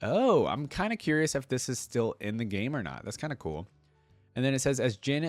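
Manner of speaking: ordinary talk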